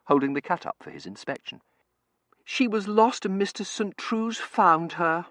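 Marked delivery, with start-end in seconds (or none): 2.4-5.3 high, slow, loud explanation; high-pitched coaxing girl; pleading daughter. A narrator's reading. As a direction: plead the case in a drawn-out high voice. Daughter interceding with father.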